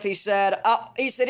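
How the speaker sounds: ordinary talk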